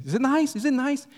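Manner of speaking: high, insistent hostess voice; eager prompting. Retold or imitated. Lady prompting the guest over soup, eagerly insistent. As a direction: prod hopefully for a compliment. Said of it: "the lady was prompting him".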